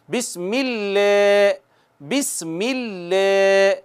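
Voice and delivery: बिस्मिल्लाह slowly, thin lam, lips pulled back; light lam. Shown as a demonstration. Teacher has students repeat the light lam slowly. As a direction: say बिस्मिल्लाह gently with a fine lam. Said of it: "कहिए भला मेरे साथ".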